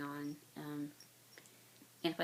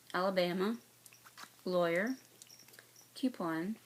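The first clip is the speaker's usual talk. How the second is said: normal talking voice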